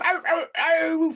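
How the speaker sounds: high yelping ows, imitating the victim; mock the screams the way they did; mimicked screams of pain. Recalling the torture, mimicking the victim's screams. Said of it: "you mock and mimic their screams".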